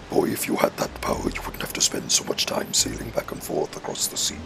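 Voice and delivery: deep, voiceless villain whisper; low breath-heavy villain rasp. Acted. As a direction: read it aloud in a soft, husky sinister overlord voice.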